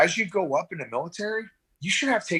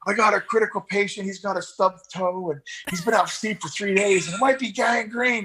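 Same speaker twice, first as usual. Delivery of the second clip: mock frantic caller; 7 semitones higher than usual. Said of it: "you know".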